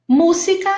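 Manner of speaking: Música called out high and loud, stressing "Mú"; teacher calling out an example word; emphasized example word. Shown as a demonstration. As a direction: present Música as a model word with clear stress on "Mú". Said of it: "antepenúltima tônica".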